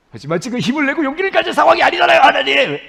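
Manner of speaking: high, fast yelled objection to God; shouted protest. Acted. Believer talking back to God's encouragement, shouted protest. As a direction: burst out in exasperated, hammed-up protest.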